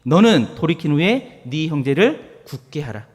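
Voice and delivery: slow, solemn Bible reading; loud, slow recitation of scripture. Acted. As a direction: read the verse aloud with weight. 한번 읽어봅니다.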